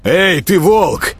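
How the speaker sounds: high, slow challenging shout; yelling. A narrator's reading. Prince hailing the wolf-man, yelling. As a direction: holler to get his attention. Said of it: "заорал Всеволод".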